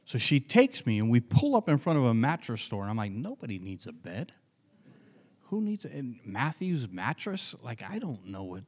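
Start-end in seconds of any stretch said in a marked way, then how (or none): 3.1-8.6 baffled mutter. Retold or imitated. Young self's baffled inner thoughts.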